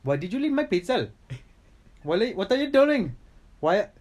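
scolding in a put-on Indian English accent. Accusing a friend over a pizza, indignant Indian accent. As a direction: play an affronted Indian English speaker. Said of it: "you're also good at doing Indian".

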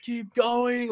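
high mock egging-on; cheering partygoers. Other partygoers urging Billy on, high mock cheer.

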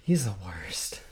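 slow hushed whisper. Real, not acted. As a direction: whisper it as an aside. Mock grumble about producer.

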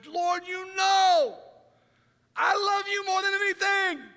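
high, loud, slow words forced out through emotion; choked plea. Acted. Peter answers Jesus, voice breaking with emotion. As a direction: push the words out as if they barely come. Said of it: "he can hardly get the words out".

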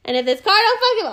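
high, loud rising rant; boiling over at the wait; hammed-up fury; mock road rage.

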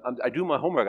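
normal talking voice

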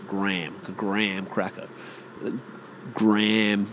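GRAHAM as "Gram"; one-syllable Graham; Graham rhyming with "ham"; American pronunciation. Shown as a demonstration. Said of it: "It's like G R A M".

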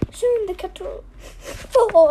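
plain reading voice

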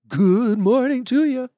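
zombie. Acted. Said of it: "A zombie noise?".

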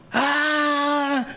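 brother bursting in with a high yell; excited yell. Retold or imitated. Brother bursting in with big news, excited yell. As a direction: shout ah as you burst in. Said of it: "meu irmão entrou gritando".

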